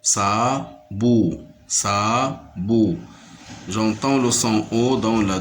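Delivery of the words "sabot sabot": SA-BOT in two separate beats; split into syllables. Shown as a demonstration. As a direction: sound out Sabot syllable by syllable. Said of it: "se compose de deux syllabes".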